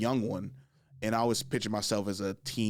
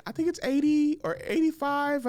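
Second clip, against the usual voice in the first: high, light guessing; a hint of the girls answering; women guessing. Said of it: "some chicks be like".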